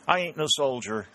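hammed-up country protest, lightly drawled; drawling farmer. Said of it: "in his drawling tone".

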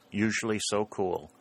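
plain reading voice

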